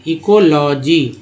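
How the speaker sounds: "Ecology" slowly, part by part; हिस्सों में उच्चारण. Shown as a demonstration. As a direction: stretch out "ecology" for learners. शब्द को हिस्सों में पढ़ाना. (धीरे-धीरे, हिस्सों में "इकोलॉजी")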